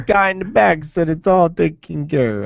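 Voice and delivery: mobster voice. Pretend. Mob waiter telling Tony the bill is paid, slow mock mobster voice. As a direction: play a wiseguy settling the bill.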